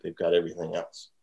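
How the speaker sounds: ordinary delivery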